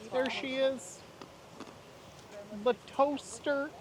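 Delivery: high, slow sing-song announcing. Pretend. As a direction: draw it out high and playful. Playful callback, sing-song high voice.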